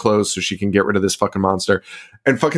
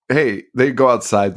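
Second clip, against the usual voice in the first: ordinary talk, nothing marked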